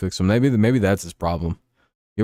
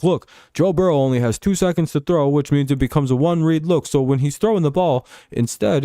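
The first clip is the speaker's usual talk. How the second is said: lightly voiced explainer, pitched up; a hint of a higher-pitched apologist; imitating pundits; 6 semitones higher than usual. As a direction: argue it like an earnest pundit, higher. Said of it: "They're like".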